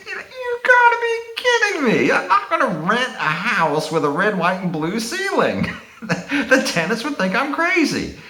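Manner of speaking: high-pitched, laughing mock protest; laughing incredulity. Landlord objecting to his friend's paint idea, laughing incredulously. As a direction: object in disbelief, laughing through it.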